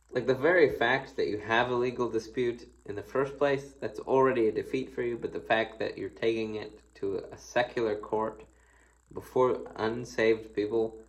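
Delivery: ordinary delivery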